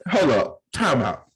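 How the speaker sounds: loud high protest, cutting in; outraged hold up. Real, not acted. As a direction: stop him loudly, half laughing. Objecting to being called country.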